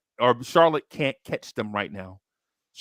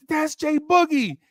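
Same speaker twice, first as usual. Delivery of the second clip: high-pitched imitation of an excited child; starstruck kid; 14 semitones higher, 4 dB louder than usual. Imitating a little kid spotting [name], starstruck cry. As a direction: squeal it like a kid spotting an idol.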